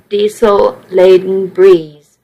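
ordinary delivery, nothing marked

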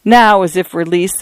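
plain reading voice

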